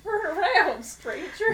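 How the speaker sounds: put-on high, loud old prospector warning; old-timey miner. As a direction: cackle it like a wild hermit miner. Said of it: "quoting miner bit".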